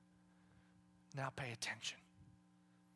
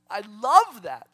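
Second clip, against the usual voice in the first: much louder, emphatic; loud delight; 13 dB louder than usual. Real, not acted. Preacher repeating his favourite definition, loud delight. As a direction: proclaim "love" out loud.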